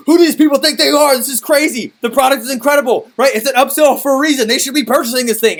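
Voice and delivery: loud, high, outraged rant; venting angrily. Retold or imitated. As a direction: reenact blowing off steam in indignation. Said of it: "I remember venting to my wife".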